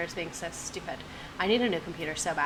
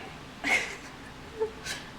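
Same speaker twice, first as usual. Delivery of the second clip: high breathless squeal of laughter; 5 dB quieter than usual. Real, not acted. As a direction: silent high wheeze laugh. After the song.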